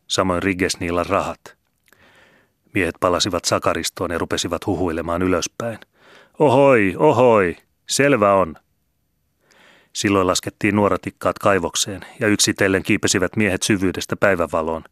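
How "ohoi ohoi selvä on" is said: shouted hail from far below; loud echoing call then settling. Acted. Men calling up from mine.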